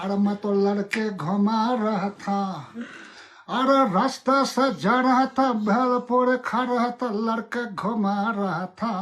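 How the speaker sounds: sing-song Bihari Hindi accent, brisk. Shown as a demonstration. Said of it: "Bihari की accent".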